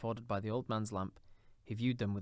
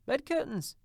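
laughing; 7 dB louder than usual. A narrator's reading. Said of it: "laughing and leaning forward".

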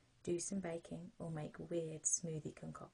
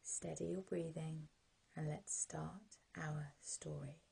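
sleep story narrator; 21 dB louder than usual. Acted.

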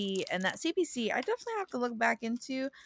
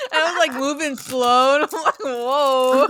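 loud laughing retelling; 6 semitones higher, 10 dB louder than usual. Real, not acted. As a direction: laugh through the words. Laughing retelling choking on caramel.

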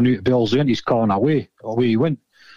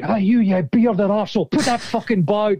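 higher-pitched imitation of an angry player; irate opponent; 7 semitones higher than usual. Player ordering a bearded opponent to put the ball out, mock angry. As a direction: retell it as an indignant yelp, pitched up.

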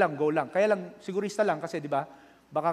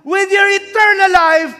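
slow, much higher and louder shouted preaching; preaching at full force; 16 dB louder, slower than usual. Acted. Pastor shouting at his online flock, full force preaching. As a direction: thunder the question at the congregation.

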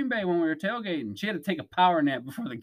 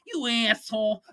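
imitating his wife's Boston accent, pitched up; 5 semitones higher than usual. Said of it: "Just like that".

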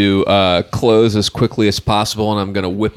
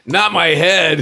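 high, drawn-out protest; mock dismay; 8 semitones higher, slower than usual. Player whose character got clubbed, mock dismay. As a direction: wail it slowly in play.

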